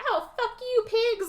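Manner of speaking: very high crazy-chick wail; mock defiance. Shot crazy chick on the ground to police, mock defiant. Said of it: "she's laying on the ground being like".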